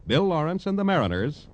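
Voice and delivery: ordinary talk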